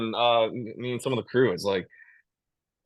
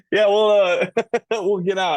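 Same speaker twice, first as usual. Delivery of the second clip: laughing; 11 semitones higher, 7 dB louder than usual. Real, not acted. Laughing off accent request.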